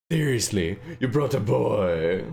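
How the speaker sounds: lightly voiced Stewie with a long, drawn-out last word. Streamer testing a voice for a game character, Stewie. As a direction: draw it out slowly in a character voice. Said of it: "this is Stewie".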